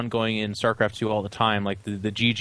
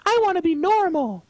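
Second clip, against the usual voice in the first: put-on very high, whining little-kid voice; pleading child; 4 dB louder than usual. Voicing children shown normal people shaking hands, mock pleading child. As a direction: squeal it like a tearful child, hammed-up. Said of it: "and they're like".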